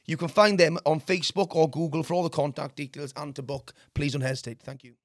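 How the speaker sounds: a soft, steady sponsor read; quiet advert voice for the local plumber. Acted. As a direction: read the plug like a radio ad. Reads sponsor ad.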